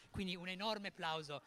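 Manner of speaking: usual speaking voice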